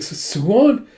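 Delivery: stammered start, high rising question; startled question. Retold or imitated. She finds Suwon slumped on her, startled question.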